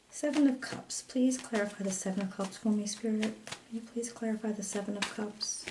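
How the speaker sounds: hushed, murmured plea to Spirit; quiet prayer. Acted. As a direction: ask the spirits softly, almost to yourself. Asks Spirit to clarify.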